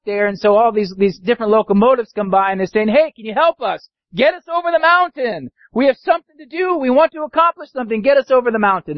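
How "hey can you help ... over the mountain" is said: high, eager cry for help; urgent high-pitched plea; pleading toys. Acted. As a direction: call out higher, begging passing engines.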